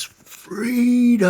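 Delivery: high, long yelled "Freedom!"; screamed battle cry. Retold or imitated. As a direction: roar it like a Braveheart battle cry. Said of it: "he screams".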